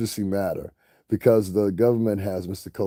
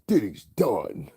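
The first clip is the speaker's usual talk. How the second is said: mock gloating; slower than usual. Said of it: "That's all you gotta say".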